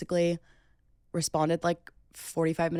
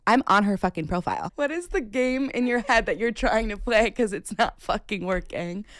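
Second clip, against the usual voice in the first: loud, raised, indignant outburst; exasperated rant; 5 semitones higher, 5 dB louder than usual. Real, not acted. Storyteller berating the man over the roommate's profile, exasperated.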